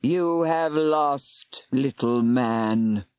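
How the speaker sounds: very slow, low mockery; mocking serpent. Serpent mocking the defeated warlock, slow scorn. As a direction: scorn the little man.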